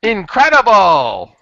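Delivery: high, slow, drawn-out announcer shout; Japanese announcer voice. Retold or imitated. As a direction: belt it like an arcade game announcer. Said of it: "in this Japanese voice going".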